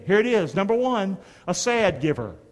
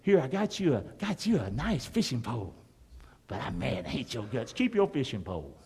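low, soft mock resentment; mad gift giver; 7 semitones lower, 6 dB quieter than usual. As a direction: hand over the gift resentfully. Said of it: "'cause you're mad".

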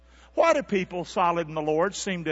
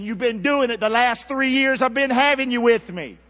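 exasperated rebuke; 4 semitones higher, 6 dB louder than usual. Acted. Imagined rebuke from Jesus.

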